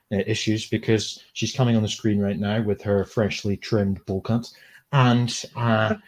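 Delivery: low, measured TV presenter delivery. Acted. Host introducing a guest on screen, low mock-formal presenter voice. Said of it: "It's my presenter voice".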